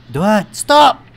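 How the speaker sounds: high, very loud yell; building to a yelled halt; yelled command. Real, not acted. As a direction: shout to halt the dogs. Yelling at dogs to stop.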